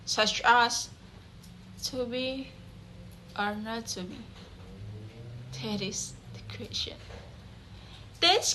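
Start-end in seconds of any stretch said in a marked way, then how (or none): 1.8-4.2 hushed recitation. Acted. Quotes Hamlet's soliloquy line. 5.6-7.0 breathy stage whisper, falling away; a hushed Hamlet musing to himself; whispered line. Acted. Quotes Hamlet's soliloquy line.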